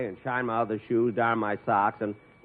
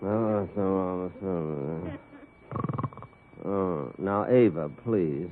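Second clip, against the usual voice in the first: sleep talking; 7 semitones lower, 6 dB quieter, slower than usual. Acted. Boss talking in his sleep.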